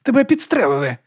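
high-pitched alarmed squeal; squeak. Acted. Monk seeing his companion shot, squeaking. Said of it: "пискнув він".

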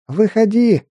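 high angry shout. Acted. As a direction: yell it out at the enemy. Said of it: "закричала девушка".

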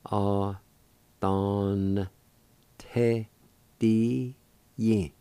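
A, don, te, di, yin each on its own; syllable by syllable. Shown as a demonstration. Teacher breaking the word into syllables. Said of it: "like this".

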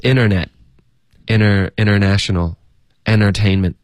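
Internet, international, entertainment as inner-net, inner-national, enner-tainment; American dropped T. Shown as a demonstration. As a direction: list them slowly, no T after N. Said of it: "这些都是一样的". (Internet等词慢读，省略t音)

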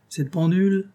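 high auctioneer's announcement. A narrator's reading. Grandelu shows off his furniture, in an auctioneer's tone. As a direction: present the item like a lot for sale. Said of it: "d'un ton de commissaire-priseur".

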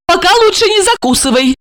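high, quick tip from stepmother; advising. A narrator's reading. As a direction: advise him briskly, pitched high. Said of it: "посоветовала Ольга".

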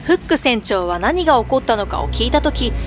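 ordinary delivery, nothing marked

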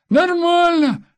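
put-on high laughing boy's shout; laughing child. A narrator's reading. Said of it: "засмеялся сын". (смеющийся ребёнок)

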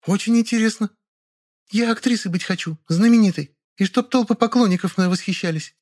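put-on high, dreamy girl voice. A narrator's reading. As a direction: gush about fame dreamily. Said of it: "размечталась Катя Лапина".

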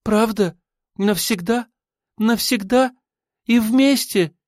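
overjoyed Ada. A narrator's reading. Ada, giddy with joy, asks her lover again and again, very high.

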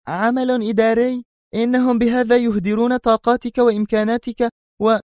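very high, incredulous female voice; indignant exclamation. A narrator's reading. She objects to his office transfer, indignant and very high. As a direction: protest in high-pitched disbelief. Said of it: "وهي تهتف في استنكار".

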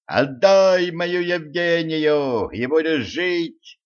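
high, long-drawn heroic call; shouted ultimatum. A narrator's reading. Елисей threatens Кощей over Евгения, shouting. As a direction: call out slowly, stretching the words. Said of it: "крикнул Елисей".

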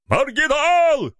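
high, drawn-out yell; shouted insult. Acted. Someone yells after fleeing Mykola, high and drawn out. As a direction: yell it after him as he flees. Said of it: "выкрикнул кто-то громко ему вдогонку".

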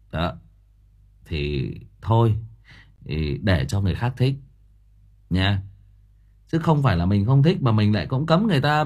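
usual speaking voice

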